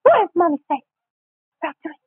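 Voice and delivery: high-pitched comic mother voice; high mock-mommy voice, broken by pauses; Stuart's mom. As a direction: prod a child in a high sing-song. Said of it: "Can you do Stuart's mom?".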